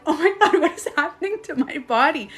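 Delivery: laughing, high astonished oh my God; amazed. Retold or imitated. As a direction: marvel at the strange feeling. Shocked by body sensations in meditation.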